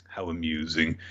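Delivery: low, soft indifference; unconcerned. Retold or imitated. The Empress watching the carnage, softly unconcerned. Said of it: "She doesn't give a fuck".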